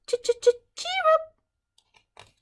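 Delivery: high chirpy birdsong voice; bird call. Acted. The little brown bird singing to the Emperor, high chirp.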